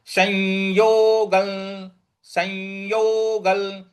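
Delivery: संयोगल spoken slowly, sounds drawn out; slow recitation. Shown as a demonstration. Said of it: "तो शं ऐसे बोलना है हमको". (धीमा स्पष्ट उच्चारण)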